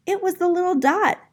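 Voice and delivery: a delighted gasp of surprise; surprised gasp. Acted. Vashti spotting her framed dot, gasping in surprise. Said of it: "she was surprised".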